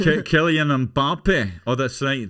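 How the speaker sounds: high, loud Scottish accent; drawn-out, emphatic Scottish accent. Shown as a demonstration. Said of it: "Scottish accent".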